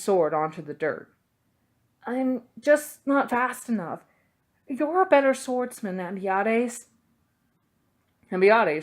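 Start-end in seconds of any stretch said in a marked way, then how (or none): 2.0-6.9 a higher-voiced boy admitting defeat; dejected boy. A narrator's reading. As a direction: let it sag like a beaten boy. Dejected Sophos voiced by narrator.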